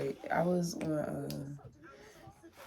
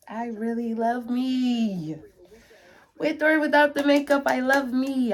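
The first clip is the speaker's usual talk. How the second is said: louder, higher, slow declaration of self-love; building joy; 7 semitones higher, 10 dB louder than usual. Real, not acted. Vlogger hyping herself up on camera, rising to a happy cry. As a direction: proclaim it, swelling with joy.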